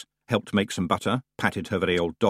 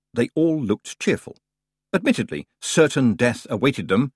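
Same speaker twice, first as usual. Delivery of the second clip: plain reading voice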